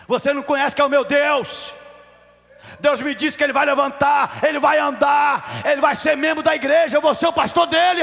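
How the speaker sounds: preaching at full force, high and loud; rising, thunderous declaration; impassioned shouting. Retold or imitated. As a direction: shout your faith at the doubters. Retells defying relatives' doubts.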